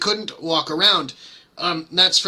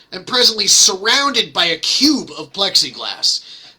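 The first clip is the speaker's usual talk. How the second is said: ordinary talk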